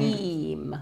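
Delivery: "Eam" with the ee drawn out; lengthened ee in "Eam"; long vowel. Shown as a demonstration. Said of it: "a long".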